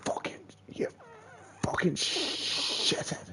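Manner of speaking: slow whispered cursing; whispered insult. Real, not acted. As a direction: hiss it under your breath. Cursing the game under breath.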